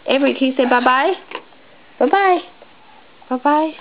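high sing-song bye-bye. Real, not acted. Prompting baby to wave bye.

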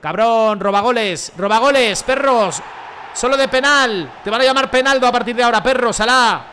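loud, heated yelling at the teammate; real angry yelling at Salah. Real, not acted. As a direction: shout him down for stealing goals. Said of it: "Le estoy gritando a Salah".

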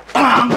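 a sharp high ping like a bell; imitated glass ping. Menirukan bunyi gelas disentil. (tiruan bunyi denting gelas seperti lonceng)

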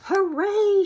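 high, loud hooray; cheer. Acted. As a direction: shout it joyfully. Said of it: "shouted the friends".

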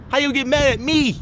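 high-pitched, exasperated protest; indignant. Real, not acted. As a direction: demand it in outraged disbelief. Indignant rant at angry servers.

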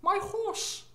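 high mock British "my horse"; mock British horse girl. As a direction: play a prim British rider saying "horse". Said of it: "How do Australians say horse?".

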